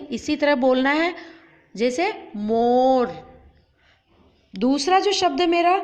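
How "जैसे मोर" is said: मोर slow with a clear ओ; slow model word. Shown as a demonstration. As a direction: say मोर slowly, round ओ. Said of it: "आपको भी इसी तरह आवाज़ निकालनी है".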